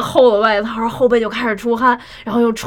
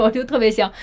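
ordinary talk, nothing marked

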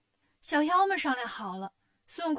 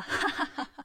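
breathy acted ha-ha-ha; gleeful laugh. A narrator's reading. Wukong hears the demon's boast, laughing gleefully. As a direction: laugh with delight at the rival's claim. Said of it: "悟空听了更乐了".

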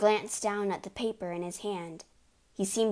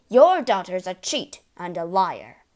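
loud indignant complaint; 10 dB louder than usual. Acted. Father complains to mother.

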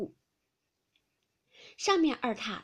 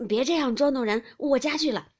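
put-on raised-voice old granny objecting; loud protest; 3 semitones higher, 10 dB louder than usual. A narrator's reading. Granny trying to flee the drinking game, loud protest. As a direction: plead to be let off, loudly.

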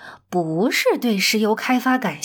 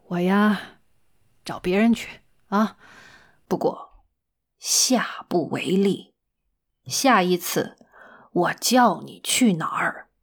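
resigned stern manager; 4 semitones lower than usual. Acted. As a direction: give in wearily, then warn firmly in a lowered voice. Manager gives in, then warns. (低沉缓慢、无奈而严肃的经理口吻)

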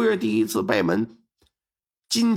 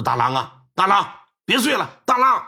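put-on father shaking his drunk son awake, calls building louder; calling to wake; 3 semitones higher, 4 dB louder than usual. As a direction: wake the drunk son, each call more urgent.

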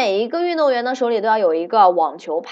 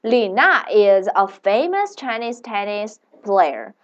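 slow, careful English sentence; slow model sentence; slower than usual. Shown as a demonstration. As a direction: read it slowly for learners. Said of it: "let's make a sentence".

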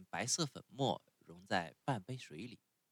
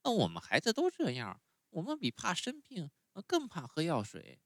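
put-on much higher, whiny little boy; puppet boy voice; 9 semitones higher than usual. A narrator's reading. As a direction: sulk like a spoiled child refusing medicine. Pinocchio voiced in story.

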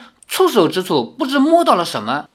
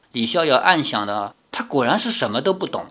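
usual speaking voice

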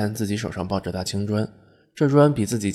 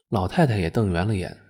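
ordinary delivery, nothing marked

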